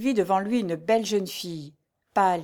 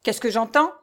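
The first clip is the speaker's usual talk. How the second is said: high, loud, menacing question; exasperated cry; 4 semitones higher, 5 dB louder than usual. A narrator's reading. Steward exasperated at the tenants' murmur, menacing. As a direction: glare round the crowd and demand. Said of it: "s'écria le majordome exaspéré".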